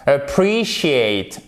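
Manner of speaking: Appreciate very slow, with a long I in the first syllable; English appreciate. Shown as a demonstration. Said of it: "pour vous expliquer comment ça marche". (Appreciate très lentement, le E dit comme un I long)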